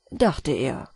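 ordinary delivery, nothing marked

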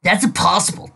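high, insistent disbelief; arguing. Acted. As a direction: argue it won't end. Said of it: "Rainbow argued".

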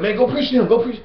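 mock a friend urging him to go preach; pushy fellow preacher. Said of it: "and he's like".

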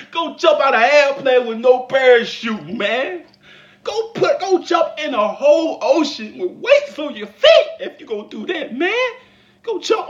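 loud, high ranting advice; hammed-up preaching rant; mock rant. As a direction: build to a high comic shout. Ranting advice, rising shouts.

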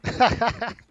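hearty burst of laughter; big laugh out loud. Real, not acted. Laughing at the game. (громкий смех)

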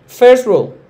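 First rule called out loud; loud announcement. Shown as a demonstration. Teacher announcing the first rule, loud. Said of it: "primera regla".

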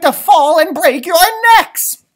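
high, loud alarmed cry; shouted warning. Acted. Mother bird shouting a warning at the babies. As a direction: yell at the children to get down. Mama Bird scolding the bats.

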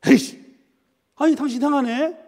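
high, loud burst of mock annoyance; acted irritation. Spouse quarrelling over dinner, acted exasperation. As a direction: play a spouse losing patience.

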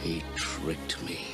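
low, slow, hushed words; stunned realisation. Acted. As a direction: let it sink in, almost whispered. Ram realises his wife is abducted.

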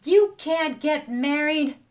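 slow, loud, stern forbidding; the stink bug forbidding the wedding, scary. A narrator's reading. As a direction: lay down the law slowly. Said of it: "said a scary voice".

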